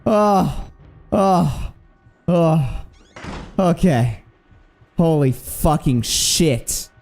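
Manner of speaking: shaken relief. Real, not acted. After escaping the monster.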